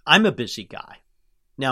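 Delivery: ordinary talk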